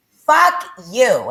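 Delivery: retold furious curse at God; angry curse. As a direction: spit it out in fury. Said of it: "I was really angry".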